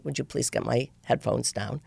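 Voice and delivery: deadpan, cold politeness; very flat aspect. Retold or imitated. Angry passenger coldly asks the attendant for headphones, flat. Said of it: "with very flat aspect".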